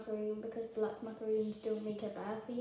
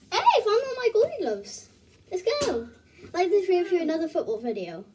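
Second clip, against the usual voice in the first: high, loud excited shout; 7 semitones higher, 11 dB louder than usual. Real, not acted. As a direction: yell it out, thrilled. Excited at finding the glove.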